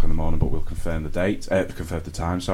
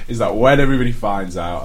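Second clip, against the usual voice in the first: ordinary talk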